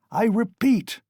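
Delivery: furious cry. A narrator's reading. Raskolnikov furiously crying at the lawyer. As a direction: hurl it at him in fury. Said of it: "Raskolnikov cried furiously".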